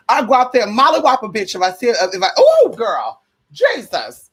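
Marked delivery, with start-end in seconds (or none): none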